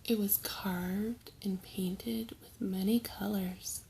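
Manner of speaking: ordinary delivery, nothing marked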